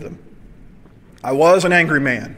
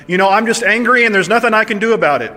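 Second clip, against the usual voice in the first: raised, fast, exasperated inner voice; frustrated self-talk; 6 semitones higher, 5 dB louder, faster than usual. Retold or imitated. Preacher voicing listeners' angry excuse, a bit raised and rushed. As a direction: rattle it off like you're fed up with yourself. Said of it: "we tell ourselves".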